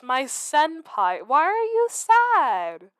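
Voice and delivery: slow, high, doting question; doting concern. Acted. Fan cooing at her gloomy senpai, slow and doting. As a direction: coo it over her sad senpai.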